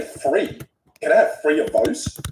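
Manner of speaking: "Free" said with an f sound for th. Shown as a demonstration. Aussie showing his old speech, "Free" with an f for th. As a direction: drop the th in "Free", say it with a plain f. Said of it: "that's how I used to talk".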